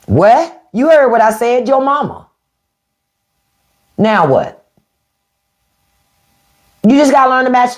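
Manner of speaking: sassy comeback. Retold or imitated. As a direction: throw the insult right back. Acted comeback in a quarrel.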